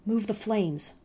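usual speaking voice

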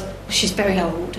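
ordinary talk, nothing marked

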